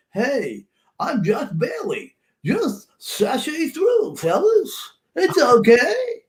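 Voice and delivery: high, slow, prancing mock voice; sing-song it way up high, teasing; mocking impression. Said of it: "Is that how I sound".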